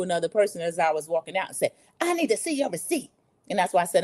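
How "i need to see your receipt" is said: higher, lightly mocking clerk voice; mock-officious receipt request; mimicking the clerk. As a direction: imitate her curt demand.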